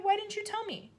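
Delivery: put-on high hurt little girl; upset child. A narrator's reading. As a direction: accuse her in shock. Matilda voiced.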